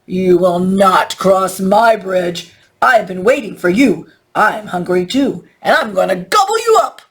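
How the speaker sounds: menacing storybook troll; growling angry troll. A narrator's reading. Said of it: "He growled".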